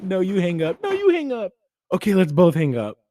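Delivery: squealing high and loud, lovey-dovey phone talk; lovestruck couple. Acted. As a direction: coo like new lovers who won't hang up.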